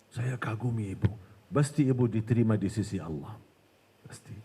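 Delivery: low, softened tempter's flattery; bisikan syaitan. Acted. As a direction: play the devil soothing her, pitched low. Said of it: "Ini bisikan syaitan loh ini". (rayuan setan bernada rendah)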